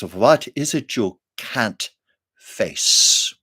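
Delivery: Mother Superior, slow and measured; each word placed with care on can't; a hint of a stern nun, slow; careful diction. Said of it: "I'm being careful with my diction here".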